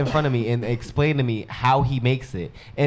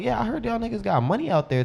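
eager question; 9 semitones higher than usual. Retold or imitated. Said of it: "I was like".

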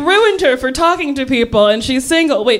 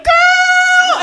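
put-on high excited "Girl!" shout; admiring shout; 3 dB louder than usual. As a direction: call out in delight. Imagined admiring classmate.

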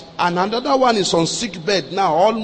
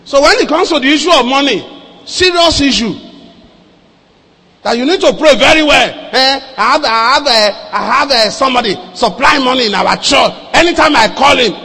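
loud, raised, insistent sermon voice; full-force preaching; 5 semitones higher, 6 dB louder than usual. Real, not acted. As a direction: press the warning on the congregation, loudly.

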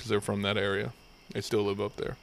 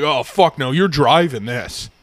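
pissed-off trooper; 7 dB louder, slower than usual. Retold or imitated. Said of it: "he was fucking pissed".

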